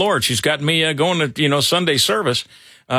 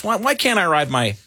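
ordinary delivery

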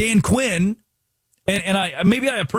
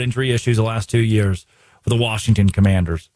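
normal talking voice

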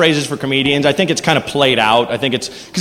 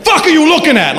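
high, loud defensive snap; tough guy snapping; 11 semitones higher, 3 dB louder than usual. Pretend. Tough guy wobbling on a unicycle yells at people staring. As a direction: bark it back at the starers.

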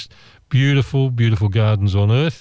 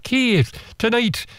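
put-on high-pitched, softer American Southern accent; badly faked South Carolina lady, coming out Scottish; attempted South Carolina accent; 11 semitones higher, 4 dB quieter, slower than usual. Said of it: "I'll try and do an ac-, her accent".

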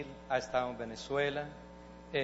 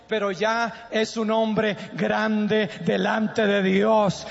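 loud, higher, slow fervent preaching; preaching at full force; 11 semitones higher, 9 dB louder, slower than usual. Acted. Pastor preaches loudly to parents about their children. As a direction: thunder it from the pulpit.

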